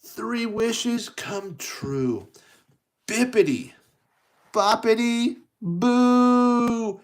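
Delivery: slow high proclamation building to loud magic words; grand fairy godmother incantation; spell casting. Acted. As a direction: draw it out, then belt the last word high.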